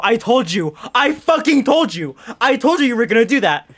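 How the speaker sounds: loud, rising told-you-so outburst; re-enacted indignant telling-off, hammering each repeat; exasperated scolding. Girlfriend scolding boyfriend who slept all night, loud exasperation.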